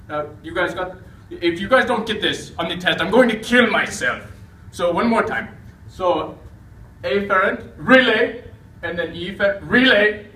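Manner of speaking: loud, high, desperate teacher; frantic shouting. Acted. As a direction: yell it like the test depends on it. Exasperated lecturer repeating key terms.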